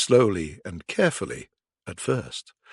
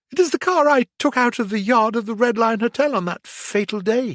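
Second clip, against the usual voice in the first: panicked; 11 semitones higher, 4 dB louder, faster than usual. A narrator's reading. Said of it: "he thought".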